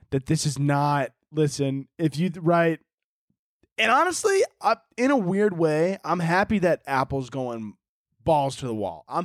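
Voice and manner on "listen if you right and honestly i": ordinary talk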